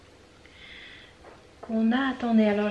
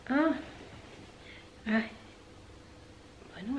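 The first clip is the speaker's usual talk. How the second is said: ordinary talk, nothing marked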